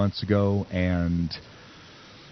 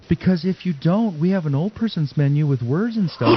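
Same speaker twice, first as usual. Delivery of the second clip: condescending waitress; 8 semitones higher than usual. Retold or imitated. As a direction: talk down to an older customer in a raised pitch. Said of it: "like, a voice and".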